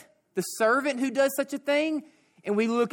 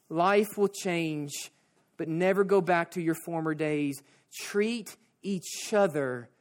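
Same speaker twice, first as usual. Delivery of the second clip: tender appeal; 5 semitones lower, 4 dB quieter than usual. Real, not acted. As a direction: draw the congregation in gently. Closing appeal of the sermon.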